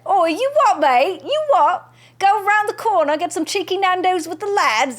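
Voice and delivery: put-on loud, high, brash Cockney accent. Streamer tries the Cockney accent a viewer asked for, loud. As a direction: shout it in Cockney like a lad down the pub. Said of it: "in Cockney voice".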